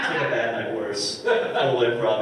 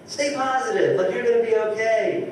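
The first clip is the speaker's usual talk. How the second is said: higher, sing-song upbeat cheer; mock positive encouragement; 10 semitones higher than usual. Comic consoling the improv guy, mock upbeat. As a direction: parody their positivity back at him. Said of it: "and I was like".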